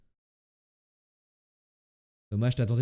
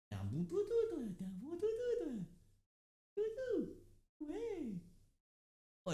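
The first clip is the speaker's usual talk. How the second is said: talking to a dog; 14 semitones higher, 7 dB quieter than usual. Real, not acted. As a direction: coo at the dog in a high soft voice. Streamer calls and pets his dog.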